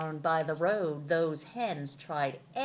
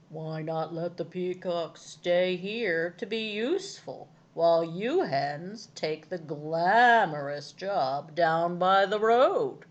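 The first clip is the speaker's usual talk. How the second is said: put-on old hound; 6 dB louder than usual. A narrator's reading. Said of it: "said the hound".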